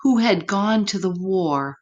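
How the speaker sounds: usual speaking voice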